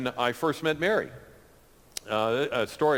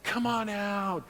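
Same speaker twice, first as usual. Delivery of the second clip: higher, pleading friend voice; begging friend; 9 semitones higher than usual. Retold or imitated. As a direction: coax him to come, pleading. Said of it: "he was, like, begging me".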